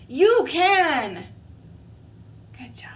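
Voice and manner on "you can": You can shouted high and loud; loud pep talk. Acted. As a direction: belt You can like you mean it.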